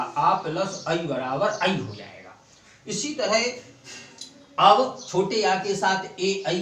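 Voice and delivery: ordinary talk, nothing marked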